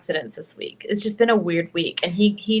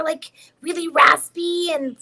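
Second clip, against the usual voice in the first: put-on higher raspy boy voice, slow; raspy little boy voice; 7 semitones higher, 3 dB louder, slower than usual. Shown as a demonstration. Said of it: "little boys are my favorite".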